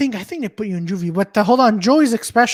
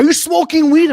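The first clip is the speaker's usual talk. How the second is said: mock cop question; 7 semitones higher, 6 dB louder than usual. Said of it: "they're like".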